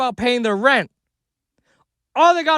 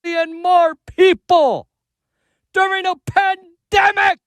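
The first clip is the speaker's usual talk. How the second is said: angry rising rant, shouting; building from heated to yelling; escalating comedy rant; 3 semitones higher than usual. Real, not acted. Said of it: "Damn, he's mad".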